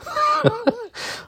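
short high-pitched giggle; a quick squeal of laughter; high squeaky laugh. Real, not acted. Laughs at own remark.

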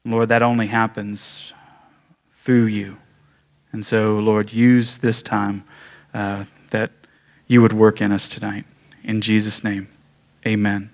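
low, hushed prayer voice. Acted. Pastor closing a prayer before the sermon, hushed and low.

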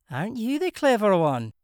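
Scottish brogue. A narrator's reading. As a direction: tease him in a Glasgow lilt. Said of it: "in a thick, musical brogue".

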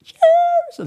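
comic falsetto urging; squeaky mock plea. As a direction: crack up into a high comic squeal. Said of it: "he goes".

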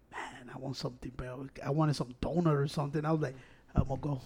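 low, soft murmur to oneself; tempted inner voice. Retold or imitated. Telling friends about craving a donut, low murmured thought.

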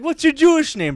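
high, loud, chirpy question; imitated eager question. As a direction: ask it brightly like a keen stranger. Imitating man asking.

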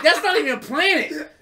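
put-on indignant childhood scoff; kid's scorn. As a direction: scoff like an annoyed kid. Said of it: "I was like".

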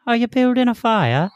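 high, silly put-on voice; put-on prank voice. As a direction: lean in with a high-pitched goofy voice. Friend's prank line before action.

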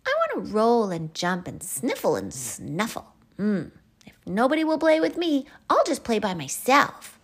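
huffy little Albie; sulky child. Acted. Albie left without playmates, loud high sulk. Albie sulks, nobody will play.

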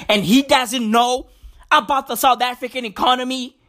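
normal talking voice

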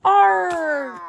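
high, loud pirate cheer; pirate shout. Acted. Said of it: "everyone shouted".

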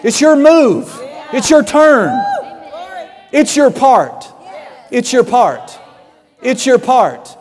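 high, loud, shouted exhortation; preacher rousing the church at full force; fiery preaching. Acted. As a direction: drive it home to the congregation.

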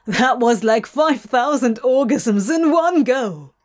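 loud, blurted-out exclamation; barked. A narrator's reading. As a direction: bark it out loud and breathless. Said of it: "Crowley barked".

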